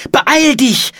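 high, loud hurrying call; urgent demand. A narrator's reading. Brother hurries his sister up the cliff, urgent demand. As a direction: urge someone on, high and forceful. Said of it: "forderte Philipp seine Schwester auf".

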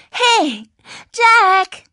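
put-on high, loud girl's hail across a distance; calling out. A girl hails Jack across the campus, calling out. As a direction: call his name high and drawn out.

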